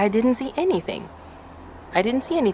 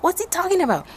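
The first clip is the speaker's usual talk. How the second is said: panicked inner voice; 12 semitones higher than usual. Retold or imitated. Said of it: "in my mind".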